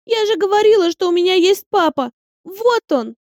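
put-on a small girl telling everyone; proud announcement. A narrator's reading. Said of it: "во всеуслышание объявила дочь".

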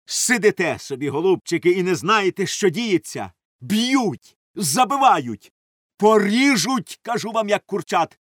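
loud, high-pitched alarmed shouting. A narrator's reading. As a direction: burst in shouting dreadful news. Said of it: "і ще на ходу кричав".

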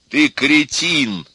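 loud, high, slow jibe; quarrelling insult. A narrator's reading. Pessimist insulting the optimist, loud quarrelling. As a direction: hurl the insult, stretching each word.

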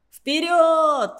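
высоко и громко, призывно; позвать за собой во весь голос; крик. Acted. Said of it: "крикнул я". (громкий клич)

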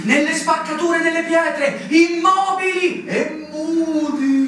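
dramatic build. Acted. Officer evokes hidden Tartars, building to a slow cry. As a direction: paint the hidden enemy, swelling to a cry.

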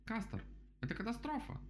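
very high-pitched lament; wailing lament. A narrator's reading. Rabbit laments to the beaver, wailing. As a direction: bemoan it in a high voice. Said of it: "запричитал он".

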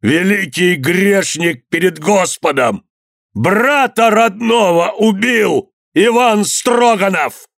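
slow, high declamation building to a cry; drawn-out, rising accusation; public denunciation. A narrator's reading. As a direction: proclaim the crime to the whole square. Convict denounces Ivan before crowd.

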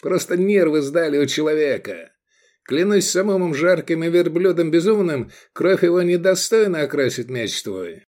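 high, hurried appeal; urgent pleading. A narrator's reading. Envoy begs the sheriff to spare his friend, pleading. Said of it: "закричал Мирарзал".

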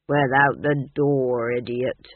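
slow, gruff porter putting down the fool; grumpy porter. A narrator's reading. Porter scolding the fool in the kitchen, slow and gruff. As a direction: drag it out, scornful.

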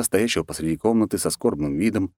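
ordinary delivery

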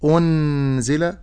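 أنزل with the nun said clearly and the last vowel stretched; wrong demo. Shown as a demonstration. Teacher warning against a clear nun. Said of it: "فلا أقول".

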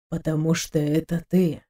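soft, low murmur to himself; low mutter. A narrator's reading. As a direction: mumble it low, as if privately. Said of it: "пробормотал Хавьер только самому ему понятные слова". (тихо бормоча себе под нос)

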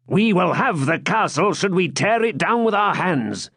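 defiant swineherd voice. A narrator's reading. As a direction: swear it with stubborn resolve. Gurth vows defiantly.

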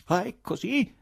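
high-pitched astonished question; stunned. A narrator's reading. Ivan cornered by the nurses, astounded. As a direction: utter it high, in disbelief. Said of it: "proferì Ivan sbalordito".

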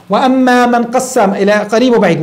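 usual speaking voice

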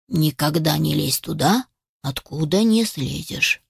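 low, measured grandad warning; admonishing old grouse. Acted. Old grouse lectures rescued kitten, sternly. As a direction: warn him like a wise old grandad.

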